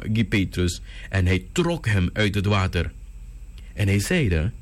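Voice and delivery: ordinary talk, nothing marked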